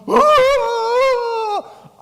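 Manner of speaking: very high, loud mock wail; ecstatic worship cry. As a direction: imitate a charismatic worshipper crying out. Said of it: "the person next to me is going".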